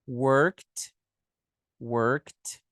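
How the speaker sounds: "worked" said "workt" in one syllable; -ed pronounced as T. Shown as a demonstration. Teacher drilling the second form, "worked" ending in T. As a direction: pop a clear T at the end of "worked". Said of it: "Segunda forma aqui é o T".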